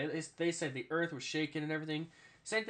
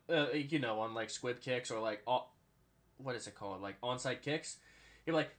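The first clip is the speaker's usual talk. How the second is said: ordinary talk, nothing marked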